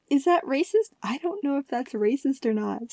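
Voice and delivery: giggly, hushed aside; nervous giggle. Real, not acted. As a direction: ask it through a small laugh. Giggles at rice field joke.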